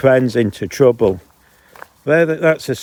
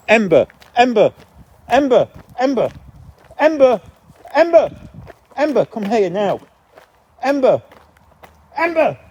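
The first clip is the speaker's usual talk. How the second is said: shouting for the dog; 13 semitones higher than usual. Real, not acted. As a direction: yell her name to bring her back. Calling runaway dog back.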